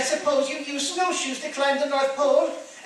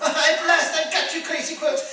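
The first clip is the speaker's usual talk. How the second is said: normal talking voice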